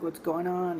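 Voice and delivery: soft, high, frightened question; imitated scared girl. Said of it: "she was just scared".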